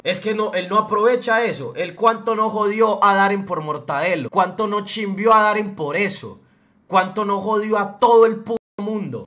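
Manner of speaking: tearing into him, building anger; angry rant. Real, not acted. Heated rant about friend's hypocrisy.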